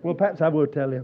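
chuckling through the words; giving in to the joke with a laugh. Real, not acted. Preacher to congregation, laughing as he gives in.

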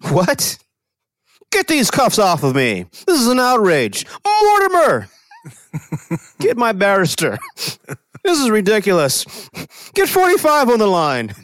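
indignant rich man being cuffed; struggling against restraints. Retold or imitated. Kraft voiced being arrested.

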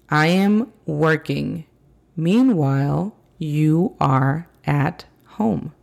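slow, careful teacher reading; slow model sentence. Shown as a demonstration. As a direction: spell it out slowly for learners. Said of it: "teacher models example sentence".

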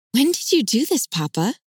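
rising high in disbelief; incredulous. Acted. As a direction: ask it like you can't believe it. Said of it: "holds a tone of incredulity".

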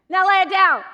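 guide's firm command; firm instruction. Retold or imitated. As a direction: command her to lay it down. Guide gives fishing order.

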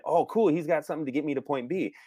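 "Oh, cool" rising high, delighted; sudden delighted realization; high excited. Retold or imitated. Imitating an excited viewer.

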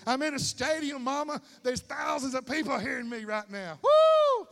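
loud, high, thrilled yell; preacher shouting to the crowd like in a stadium, all-out excited yell. Real, not acted. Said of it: "I'm getting excited".

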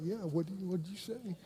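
soft, feeble backup to a tough friend; mock-challenge, trailing off very quietly; unintimidating echo. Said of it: "I wasn't really that intimidating".

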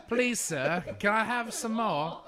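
high, slow, laughing English orphan plea; joking about Oliver Twist, the begging orphan line; mock Oliver Twist.

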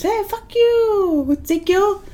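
stretched high call, done lightly; TikTok video impression. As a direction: imitate a funny dubbed video voice. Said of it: "a TikTok video comes to my mind".

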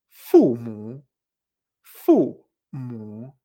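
slow low "fumu", first syllable dropping, second dipping; fall, then curved tone. Shown as a demonstration. Teacher modelling parents, "fumu" falling then curved. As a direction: drop "fu", draw "mu" through a low curve. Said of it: "con esta entonación".

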